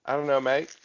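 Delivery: tentative mock Australian; Australian accent. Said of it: "is that, is that Australian?".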